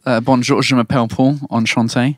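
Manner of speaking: lightly demonstrated English accent in French; accent anglais. Shown as a demonstration. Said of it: "tu parles en français avec un accent anglais". (français avec un léger accent anglais joué)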